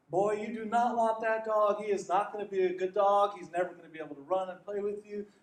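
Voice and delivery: mock stern farmer; farmer warning. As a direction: talk the boy out of it firmly. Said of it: "the farmer's like".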